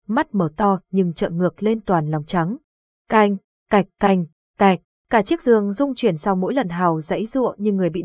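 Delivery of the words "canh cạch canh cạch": loud clattering knock imitated with the voice; rattling bed noise. A narrator's reading. Narrator mimics the bed rattling as the boy convulses, loud clatter. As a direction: rap out the bed shaking against the floor.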